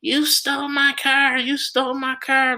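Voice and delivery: high-pitched imitation of a screaming woman; mock screaming. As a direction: screech it in a mocking high voice. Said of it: "screaming at the top of her lungs".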